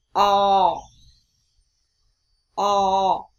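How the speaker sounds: loud open o, mouth wide; short o vowel. Shown as a demonstration. Teacher recapping the mouth shape for o. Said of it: "hãy mở miệng rộng, sau đó phát âm".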